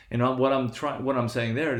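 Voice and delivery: normal talking voice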